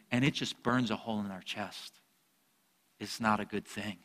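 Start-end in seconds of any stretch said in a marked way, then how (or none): none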